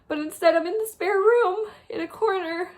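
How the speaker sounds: fighting back sobs while telling where she sits; crying. Real, not acted. Telling viewers about her dad's death, crying. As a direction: voice breaking into real tears. Grieving dad, crying in corner.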